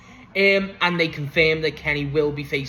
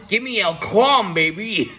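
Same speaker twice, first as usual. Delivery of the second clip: higher, louder, drawn-out call; excited fan cheer; 4 semitones higher, 5 dB louder, slower than usual. Real, not acted. Fan wanting El Clon in the team, excited cheer. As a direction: stretch it out like a fan demanding a favourite. Fan calling for a favourite wrestler.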